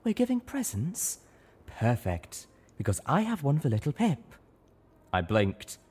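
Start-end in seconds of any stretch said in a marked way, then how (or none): 0.0-4.4 high, lilting pony mare voice; singsong mare. A narrator's reading. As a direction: chime it out like a gracious lady. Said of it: "Velvet Remedy sang".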